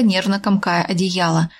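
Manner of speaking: plain reading voice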